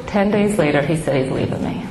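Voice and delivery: ordinary talk, nothing marked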